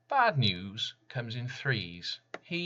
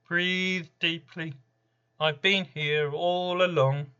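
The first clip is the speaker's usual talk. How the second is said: calm the listener while looming over them; Random's voice; 10 semitones higher, 7 dB louder than usual. Acted. Random speaks beside your shoulder.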